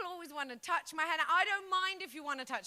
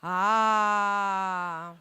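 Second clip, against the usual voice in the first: mock gasp ah; 6 semitones lower, 10 dB louder than usual. Hair blocking side view.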